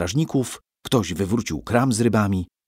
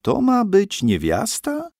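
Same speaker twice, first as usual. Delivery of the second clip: high, slow, puzzled monk; monk's puzzled query; 11 semitones higher than usual. A narrator's reading. Monk in the dark, puzzled and high. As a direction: squint at it in disbelief.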